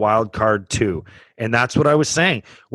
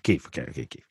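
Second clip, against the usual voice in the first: ordinary delivery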